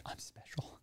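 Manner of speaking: hushed, voiceless self-congratulation; mock-awed secret whisper. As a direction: whisper it to yourself, starstruck. Awed reaction to Tyra's praise.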